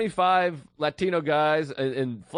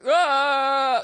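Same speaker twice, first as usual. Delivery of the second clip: raised, mocking grown-up wail; mocking baby cry; 11 semitones higher, 5 dB louder than usual. Comic as landlord mocking his baby, loud mimicked wail. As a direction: mimic a baby's cry to make fun of it. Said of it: "He's making fun of his baby".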